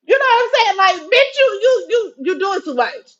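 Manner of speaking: high, loud, cracking exasperation; screeching outrage at an overdemanding woman; exasperated yelling. Retold or imitated. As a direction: blow up at him, voice breaking. Exploding at young man's demands.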